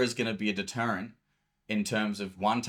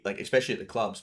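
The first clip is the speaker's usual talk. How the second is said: ordinary delivery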